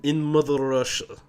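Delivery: put-on weak, hammy Russian accent; bad Russian accent. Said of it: "that was a really bad Russian accent".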